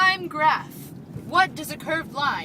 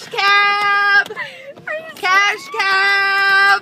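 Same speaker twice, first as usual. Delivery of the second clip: loud, high show name cheer; excited shout; 5 semitones higher, 8 dB louder, much slower than usual. Acted. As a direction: belt the name like a jingle. Host repeats show name.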